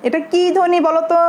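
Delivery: ordinary talk, nothing marked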